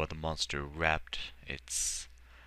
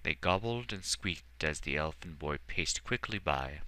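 usual speaking voice

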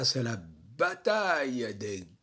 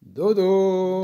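appel chantant plus aigu et fort; dodo tendre; 4 semitones higher, 11 dB louder than usual. Real, not acted. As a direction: berce les enfants vers le sommeil. Mamie dit bonne nuit aux enfants. (mamie chante dodo aux enfants)

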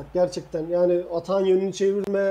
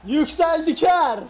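excited; 9 semitones higher, 6 dB louder, much slower than usual. Real, not acted. Co-host after goal.

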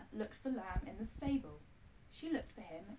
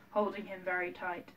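ordinary delivery, nothing marked

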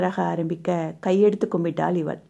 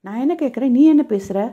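louder, higher irritated yell; higher, louder fed-up yell at her; annoyed shout; 4 semitones higher, 6 dB louder than usual. A narrator's reading. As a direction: snap at her in exasperation. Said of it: "என கடுப்பாய் கத்தினான்".

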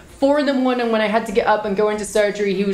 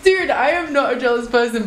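usual speaking voice

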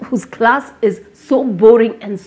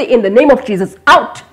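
commanding the storm; 3 semitones higher, 5 dB louder than usual. Shown as a demonstration. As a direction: cast the storm out with authority. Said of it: "speak to that storm and say".

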